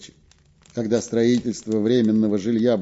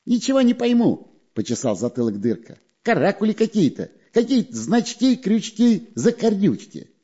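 high, squeaky little spy; high sneaky spy voice; 10 semitones higher than usual. A narrator's reading. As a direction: pitch him up like a sly weasel.

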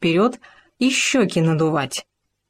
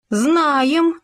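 pompous; 9 semitones higher than usual. Acted. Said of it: "важно сказал суслик".

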